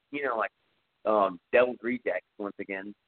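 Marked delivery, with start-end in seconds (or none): none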